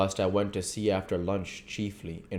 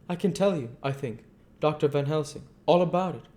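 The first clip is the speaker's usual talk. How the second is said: put-on higher, louder voice for a polite young woman; polite young wife; 9 semitones higher, 3 dB louder, faster than usual. Mina to the doctor, offering her hand, polite and gracious. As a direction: answer with gracious warmth.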